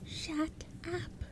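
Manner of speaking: very high, soft goblin snap; high irritable goblin chorus. Acted. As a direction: snap at him together. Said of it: "they said irritably".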